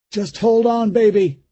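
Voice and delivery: father calling over the shrieks to his daughter; straining reassurance. A narrator's reading. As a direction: hold her steady through the strain. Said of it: "he said to her".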